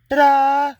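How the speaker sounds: high, loud mock ta-da, presenting a mess; sarcastic showman's reveal of bad work; mock fanfare over shoddy work. Mocking the sloppy insulation job.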